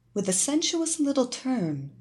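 ordinary delivery

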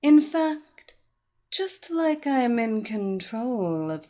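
slow, droning hypnotic voice; droning hypnotist voice. Acted. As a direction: drone on slowly, lulling. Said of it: "She droned on".

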